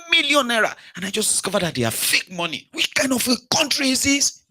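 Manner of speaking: high, worked-up victim voice, well above his own; outraged shopkeeper. Acted. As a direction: play the cheated shopkeeper, indignant. Acted skit, cheated shopkeeper character.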